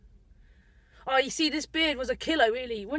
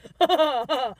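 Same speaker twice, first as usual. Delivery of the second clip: big pulsing belly laugh; loud laugh; 7 dB louder than usual. Real, not acted. Driver amused by his own Oxfordshire line, loud laugh. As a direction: burst out laughing loudly.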